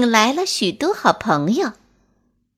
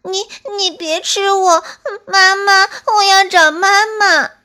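high, loud frightened wail; a terrified little squirrel calling for mama; squeaky stammering plea, close to tears; crying in fright; 9 semitones higher, 6 dB louder than usual. Acted. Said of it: "哭着说".

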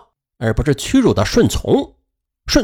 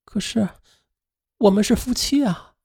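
high, soft and hurt; quietly hurt; 7 semitones higher, 4 dB quieter than usual. A narrator's reading. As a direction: plead softly, wounded. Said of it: "于是她委屈地说".

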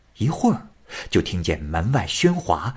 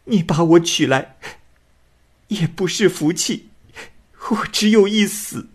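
put-on higher, despairing young bride voice; a weeping bride ready to die rather than marry; tearful young woman; 8 semitones higher than usual. A narrator's reading. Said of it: "过了半天才说".

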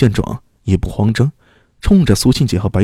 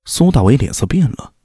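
ordinary delivery

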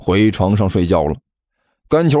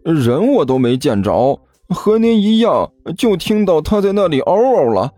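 rueful higher voice; wry protest; 7 semitones higher than usual. A narrator's reading. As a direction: plead helplessly that you know nothing. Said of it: "汪旭苦笑着说道".

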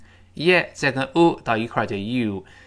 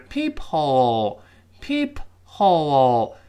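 slow reading; 3 dB louder, much slower than usual. Shown as a demonstration. Teacher showing the slow form, syllables apart. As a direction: PEEP and HOLE drawn out with a clear H. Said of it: "我们读慢的情况下".

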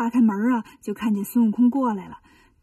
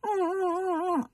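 soft high sobbing whimpers; quiet hammed-up crying hums; whimpering sobs; 6 semitones higher, 7 dB quieter than usual. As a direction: sniffle like a hurt child wiping tears. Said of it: "一抹眼泪儿".

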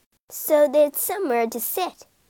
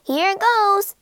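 cat's voice; 8 semitones higher than usual. A narrator's reading. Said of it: "said the cat".

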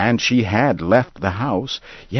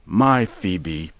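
plain reading voice

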